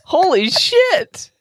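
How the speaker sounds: high-pitched, laughing disbelief; appalled comic outburst; incredulous outrage. Real, not acted. Appalled, amused verdict on script.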